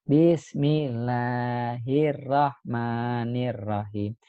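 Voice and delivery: slow, drawn-out bismillah, syllable by syllable; young child reciting a prayer. Acted. Kindergartner reciting bismillah in front of proud parents, slowly. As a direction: recite haltingly like a small child performing. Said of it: "berani maju ke depan aja baca doa".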